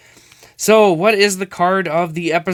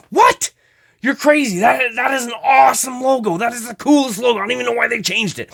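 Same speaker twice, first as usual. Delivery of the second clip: lightly higher, excited mock fan; 8 semitones higher, faster than usual. Collector imitating a fan who loves the logo. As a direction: play an outraged fan, a touch higher. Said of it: "I know some people are like".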